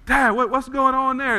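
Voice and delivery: put-on child voice full of wonder; little boy's voice. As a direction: ask it wide-eyed as a little boy. Little boy asks his dad.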